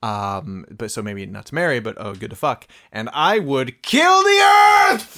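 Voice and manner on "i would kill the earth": slow, loud, high villain roar; villain shout. Pretend. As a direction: proclaim it like a mad overlord. Villainous declaration, mock evil.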